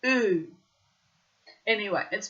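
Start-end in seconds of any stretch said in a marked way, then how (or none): 0.0-0.5 mock ooh. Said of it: "can we all say together".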